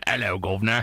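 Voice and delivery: low-pitched Cockney greeting; Cockney British accent. Shown as a demonstration. Co-host asks for his England one, Cockney accent. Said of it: "do your, uh, England one".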